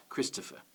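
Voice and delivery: "Christopher" with Chris stressed, the rest unstressed; stress on first syllable. Shown as a demonstration. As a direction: lean on Chris in "Christopher", let the other two syllables go. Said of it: "the rest are two unstressed".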